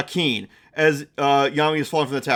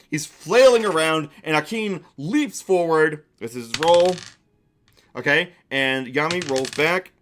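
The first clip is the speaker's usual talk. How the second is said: normal talking voice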